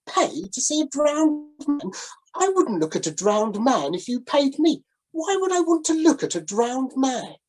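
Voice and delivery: the gentleman refusing the sailor's grim show; appalled gentleman. Acted. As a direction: recoil from the idea in prim horror. Gentleman voiced in story.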